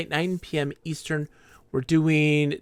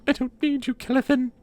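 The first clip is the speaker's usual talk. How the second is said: melodramatic mock anger; 6 semitones higher, 9 dB louder than usual. Mother angrily sends child off.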